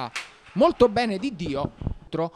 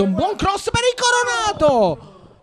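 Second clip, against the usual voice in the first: rising excitement; 12 semitones higher, 3 dB louder, slower than usual. Real, not acted. Cross into box.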